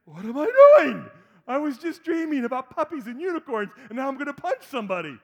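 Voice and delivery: mock groggy confusion. Groggy son waking mid-fight, mock bewildered. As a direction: sound half-asleep and baffled. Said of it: "he was like".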